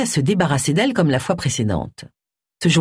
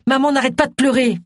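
high, agitated complaint; restrained narrated outburst; angry yell; 8 semitones higher than usual. A narrator's reading. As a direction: protest on a raised pitch without shouting. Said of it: "hurla-t-il".

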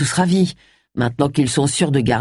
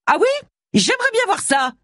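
much higher, taunting challenge; retorting. A narrator's reading. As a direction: throw the dare back, pitched way up. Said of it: "rétorqua l'intéressé". (réplique provocante, voix très aiguë)

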